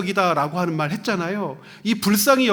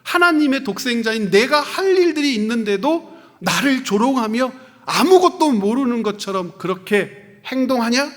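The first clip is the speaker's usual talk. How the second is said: mock thundering rebuke; 5 semitones higher, 5 dB louder than usual. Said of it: "사람들을 막 호통치시는 거예요?".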